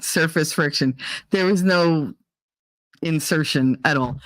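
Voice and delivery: ordinary talk, nothing marked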